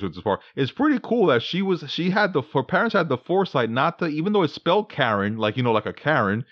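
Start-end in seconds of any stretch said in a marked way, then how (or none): none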